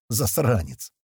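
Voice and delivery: plain reading voice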